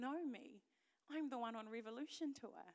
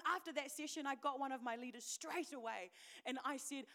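normal talking voice

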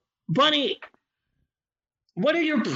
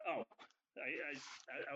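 ordinary talk

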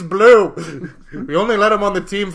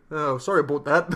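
lower, softer mock Canadian apology; half-hearted Canadian accent, sheepishly polite; attempted Canadian accent; 5 semitones lower, 5 dB quieter than usual. Failed try at Wolverine's accent, apologetic mock Canadian. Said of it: "don't know how to do a Cana".

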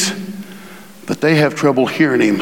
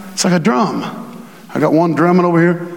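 ordinary talk

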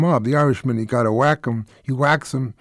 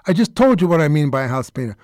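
ordinary delivery

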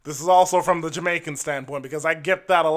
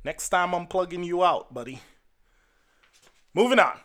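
ordinary talk

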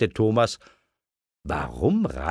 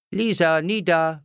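put-on higher-pitched child repeating a rhyme; playful echo; 8 semitones higher than usual. A narrator's reading. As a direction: echo it in a high little voice. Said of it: "wiederholte Lilli". (spielerisch nachplappernd)

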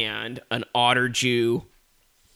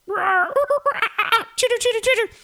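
animal chatter; 4 dB louder than usual. Acted. Circus animal buddies cheering as a squeaky chittering crowd. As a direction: squeak like a pack of little animals. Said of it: "And they're like".

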